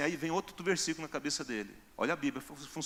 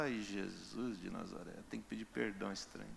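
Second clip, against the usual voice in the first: reluctant grumble; 4 semitones lower, 15 dB quieter than usual. Retold or imitated. Man grumbling at God's command.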